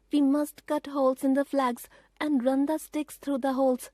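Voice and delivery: put-on a boy realising the danger; boy Peter, urgent. A narrator's reading. Peter warning his sisters about the train, urgent.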